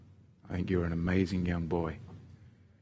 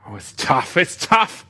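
tough; 8 semitones higher, 9 dB louder than usual. Real, not acted. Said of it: "Making me emotional now".

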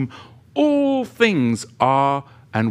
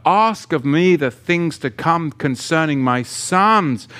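louder, emphatic preaching read; scripture preaching; 4 dB louder than usual. Acted. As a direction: proclaim the verses to the congregation. Reads scripture with preaching force.

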